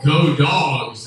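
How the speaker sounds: loud cheer of a fan chant; yelling go dogs. Retold or imitated. Tubman imagined yelling a team cheer, loud.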